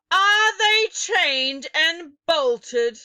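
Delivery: high, loud, slow menacing witch; loud commanding witch voice; stern witch demand. Acted. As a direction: drag it out to intimidate the crowd. Grand High Witch demands of audience.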